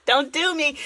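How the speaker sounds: high cackle breaking into the words; real laugh spilling over a protest; giggling don't do me through laughter. Real, not acted. Laughs at her own story.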